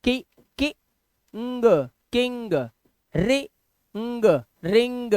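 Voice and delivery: "कि", "अंग", then blended "किंग"; sounding out NG words. Shown as a demonstration. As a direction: break it into parts, then join: "रिंग". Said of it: "एनजी है ना".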